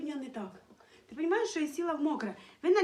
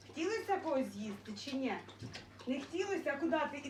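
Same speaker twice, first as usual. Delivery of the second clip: ordinary delivery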